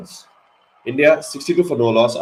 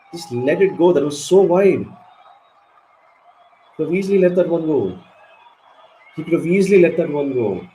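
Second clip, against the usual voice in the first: higher, frustrated telling-off of the batsman; real exasperated fan; exasperated scolding; 4 semitones higher than usual. Real, not acted. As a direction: scold him for not leaving it.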